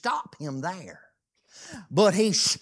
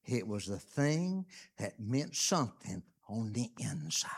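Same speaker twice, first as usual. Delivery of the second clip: lower, hushed whisper; 9 semitones lower, 10 dB quieter than usual. Real, not acted. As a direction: let it drop to a whisper to the congregation. Whispered point in sermon.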